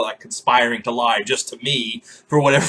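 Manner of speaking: normal talking voice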